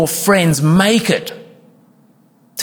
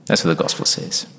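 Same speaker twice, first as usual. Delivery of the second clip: breathy whispered aside, lower and softer; whispered conviction; hushed whisper; 9 semitones lower, 5 dB quieter than usual. Real, not acted. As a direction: let it drop to a whisper for weight. Sermon point, then hushed restatement.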